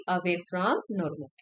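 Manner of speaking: Away from normal drawn out slowly and softly; teacher spelling out the rule for students, slow and soft; slow, emphatic recital. Shown as a demonstration. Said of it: "कहाँ पर गई?".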